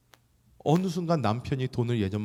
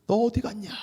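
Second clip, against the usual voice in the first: higher calling voice; 10 semitones higher than usual. Acted. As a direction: call out for the missing one. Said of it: "하나님 외치십니다".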